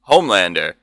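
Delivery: normal talking voice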